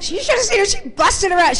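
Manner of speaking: high, amused retelling of the spirit; laughing, high voice. Retold or imitated. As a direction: toss it off with a laugh. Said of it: "She's saying it like that and laughing".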